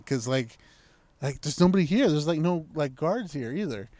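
normal talking voice

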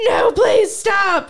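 high, loud, slow drawn-out plea; mock scream. Friend keeps screaming in the car, mock plea. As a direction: stretch out a playful scream. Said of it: "screaming like that".

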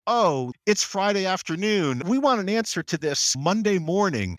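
high, breezy mock-client voice; lightly mocking pushy client; mock demanding client. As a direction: parody the client's casual demand. Mocking impatient business clients.

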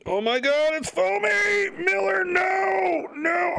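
high, drawn-out panicked yell; panicked shout. Acted. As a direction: scream as if it's all going wrong. Acting out a foam mishap.

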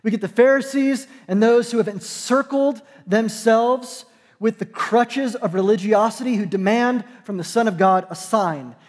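ordinary talk, nothing marked

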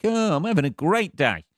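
carefree prey animal, blissfully unaware; dim happy prey. Retold or imitated. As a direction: voice a dopey animal having the best day. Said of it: "its prey's just standing there like".

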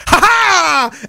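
mock-triumphant cackle; gleeful whoop. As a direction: whoop like a prankster flipping a table. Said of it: "grabs the table with both hands and goes".

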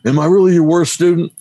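hint of a kid close to tears asking meekly; timid chastened kid. Chastened kid asks teacher.

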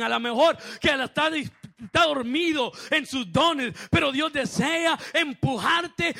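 loud, driving pulpit shout; full-force preaching. Real, not acted. Preacher rousing sleepers in their gifts, full-force shouted preaching. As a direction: build from slow proclamation to a long held peak.

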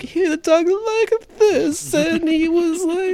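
mocking a bad Italian accent, high and slow; Jared Leto's Italian accent. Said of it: "Jared Leto was like".

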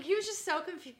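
normal talking voice

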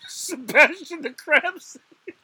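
can't stop laughing while saying it; real giggle fit breaking up the line. Real, not acted. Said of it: "I don't know why I'm laughing".

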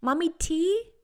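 put-on high toddler asking "Mommy, tea?"; child voice. Shown as a demonstration. Therapist imitating a toddler asking mommy, high. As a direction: pipe up like a small child. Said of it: "they'll say T".

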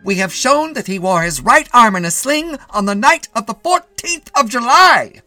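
high, loud courtroom oratory; shouted defence. A narrator's reading. Defence attorney arguing for his client, shouting. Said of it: "shouted Mitty's attorney".

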